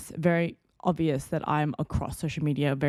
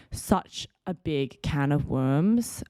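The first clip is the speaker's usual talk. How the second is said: usual speaking voice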